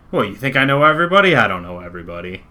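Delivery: rattled tough guy talking quickly; failed intimidation. Acted. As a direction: protest loudly, then back down in pitch. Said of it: "intimidating. He's just failing".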